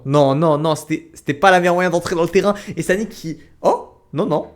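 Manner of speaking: excited dismay. Real, not acted. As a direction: react live to the bad move. Caster reacts to a bad move.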